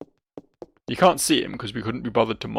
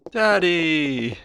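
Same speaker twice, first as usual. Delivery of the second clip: put-on high, drawn-out child's call; falling, stretched-out kid voice; child calling Daddy; 4 semitones higher than usual. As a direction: call out for your father like a little kid.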